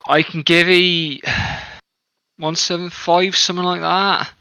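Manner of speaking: a quartermaster at the end of his rope, pushing back hard on price; harried quartermaster. Acted. Halfling quartermaster haggling with the party, exasperated.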